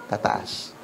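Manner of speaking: Tataas breathed out in a whisper, no voice; whispered echo. Shown as a demonstration. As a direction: whisper the word Tataas to yourself. Preacher echoes key word. (pabulong na inulit ang Tataas)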